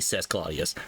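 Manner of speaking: ordinary delivery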